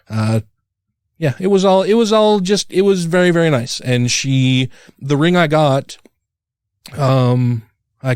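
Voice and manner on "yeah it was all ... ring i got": ordinary talk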